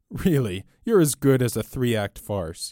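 loud, high cry of delight; delighted yell. A narrator's reading. Robber delighted by the priest's question, yelling with delight. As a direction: crow with delighted amusement. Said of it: "Flambeau yelled with delight".

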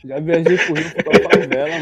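laughing. Real, not acted. Laughing while telling favela trip.

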